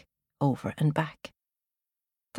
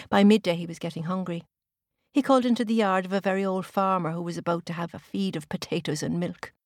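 ordinary delivery

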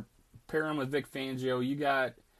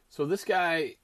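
usual speaking voice

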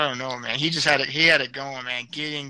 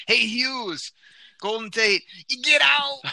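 high, loud shouted call-out; shouting; 9 semitones higher, 5 dB louder, slower than usual. Acted. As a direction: yell the catchphrase at him. Catchphrase shout at a listener.

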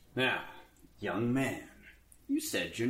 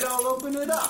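rising panic; 11 semitones higher than usual. Acted. Rising panic as the door opens.

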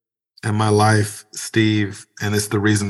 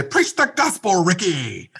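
much higher mock-preacher call; kids teasing dad; 10 semitones higher than usual. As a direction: tease like a kid goading a pastor. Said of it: "they come up to me and say".

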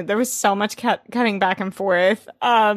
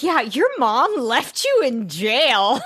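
mock sassy Blair; 5 semitones higher, slower than usual. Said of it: "she's like".

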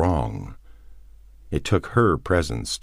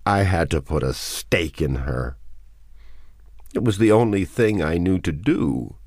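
anguished justification, building higher; terrible voice; 3 semitones higher than usual. A narrator's reading. Man confesses staking his wife, anguished. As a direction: build from dread toward breaking. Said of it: "in a terrible voice".

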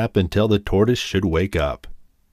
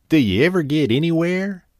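higher, scornful taunting question; contemptuous laugh; 7 semitones higher than usual. A narrator's reading. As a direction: sneer it out, mocking. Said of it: "he asked with a mocking laugh".

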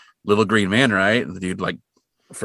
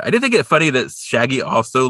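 normal talking voice

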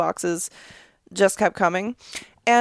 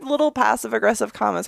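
ordinary delivery

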